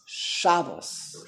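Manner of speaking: slow "Shabbos" with a strong first syllable, SHA-bos; Yiddish first-syllable stress. Shown as a demonstration. Teacher gives the Yiddish word, "Shabbos" stressed on SHA. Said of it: "accent is on the first syllable".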